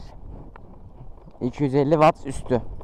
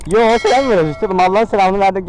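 louder and higher calling out; loud greeting; 4 semitones higher, 4 dB louder than usual. Real, not acted. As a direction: call out a friendly hello. Greeting passersby loudly.

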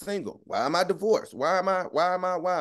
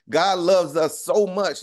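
preaching emphasis; 5 semitones higher, 5 dB louder, slower than usual. Acted.

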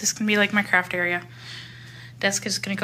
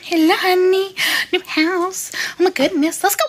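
gushing pet greeting; 8 semitones higher, 5 dB louder than usual. Real, not acted. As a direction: fuss over the dogs in an excited high voice. Greeting dogs in new house.

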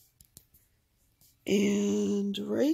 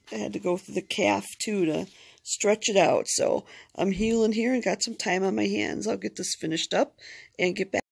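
ordinary talk

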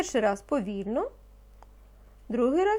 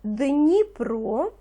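teacher dictating single words, slow and distinct; slow dictation; much slower than usual. Acted. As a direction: give each word slowly so children can write. (повільний диктант учителя)

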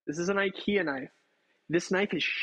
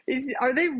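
surprised; 7 semitones higher, 5 dB louder than usual. Real, not acted. Startled by a text.

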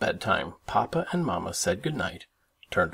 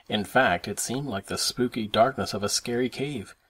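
plain reading voice

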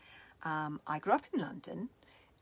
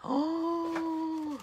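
dramatic gasp; 11 semitones higher than usual. Acted. Dramatic reaction ending the reading.

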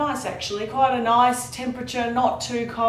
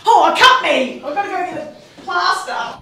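mock surprise; 9 semitones higher, 3 dB louder than usual. Jokes goosebump cut him.

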